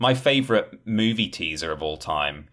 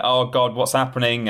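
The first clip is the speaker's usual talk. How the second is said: higher, louder panicked cry; mock panic; 6 semitones higher, 4 dB louder than usual. Soldiers panicking on the comms, mock panic. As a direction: imitate a frightened soldier losing it. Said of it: "people all panicking and screaming".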